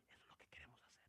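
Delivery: whispered aside to the room; a hushed confiding whisper. Real, not acted. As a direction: drop to a secret whisper.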